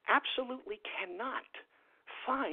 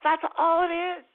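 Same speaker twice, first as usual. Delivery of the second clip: higher, louder put-on voice; high mock voice; 7 semitones higher, 11 dB louder than usual. Mock character summing up for listeners, high loud voice.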